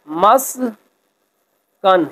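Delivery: مسکن sounded out very slowly, mas-kan; careful pronunciation. Shown as a demonstration. Teacher modelling pronunciation, very slow. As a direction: draw مسکن out syllable by syllable. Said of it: "درست تلفظ واضح کریں".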